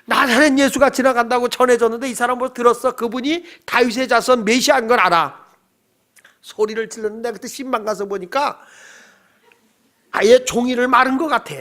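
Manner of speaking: ordinary delivery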